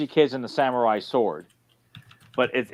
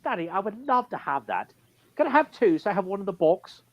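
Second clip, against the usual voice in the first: put-on high, sweet spoiled child; spoiled kid voice; 7 semitones higher than usual.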